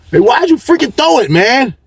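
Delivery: high-pitched mock protest; imitated complaint. Ryan complains about the throw.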